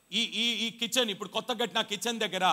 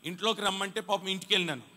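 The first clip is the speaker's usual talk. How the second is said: ordinary talk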